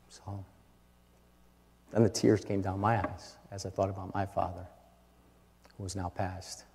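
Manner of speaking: low, soft, halting recollection; moved, hushed. Real, not acted. Preacher recalling his late father during daughter's dance, moved and hushed. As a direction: let it sink low and quiet with grief.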